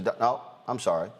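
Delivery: ordinary talk, nothing marked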